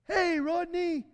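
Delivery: loud, high call across the store. Retold or imitated. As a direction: shout his name to get his attention.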